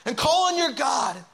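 high, forceful rousing cry; commanding shout easing into urgent appeal; urgent captain. Acted. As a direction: rouse the sleeper as the storm rages. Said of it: "The captain went down to him and said".

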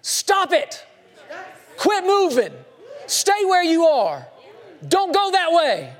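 high urgent yells of warning; frantic high yelling shepherd. Pretend. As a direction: shout them back from the edge. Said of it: "would rather your shepherd yell".

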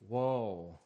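low, sighed "Woe", falling away; vocalized sigh. Shown as a demonstration. Preacher shows how woe can be said, as a low sigh. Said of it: "is a vocalized sigh".

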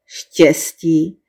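mildly grumpy, questioning owl; rozmrzelá sova. A narrator's reading. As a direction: say it with weary, grumpy doubt. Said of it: "rozmrzele zívla sova". (mírně rozmrzele)

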